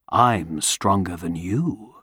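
low, soft and calm; gentle, confident reply; warm beaming sun voice. Acted. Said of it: "The sun listened quietly and smiled".

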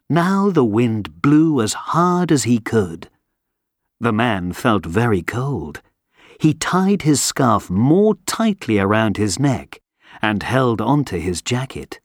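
usual speaking voice

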